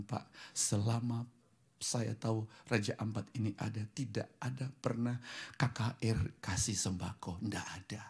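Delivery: ordinary delivery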